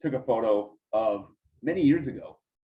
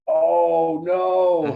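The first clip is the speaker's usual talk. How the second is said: oh no; 5 semitones higher, 8 dB louder than usual. Retold or imitated. Caught by Karl Malone.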